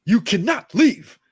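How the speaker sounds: loud, slow, high mock command; Will Smith imitation. Father to son Jaden at the table, imitated command. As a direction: boom it out word by word, exaggerated. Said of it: "the way he said, "You cannot leave".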